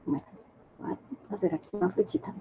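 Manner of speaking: very soft, hurried murmur; talking to oneself. Real, not acted. Teacher drawing a little arrow, quick murmur to self. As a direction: say it under your breath as you work.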